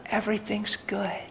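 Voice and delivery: soft, half-whispered reassurance; hushed and calming. Retold or imitated. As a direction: settle her down softly. Reassuring a worried woman.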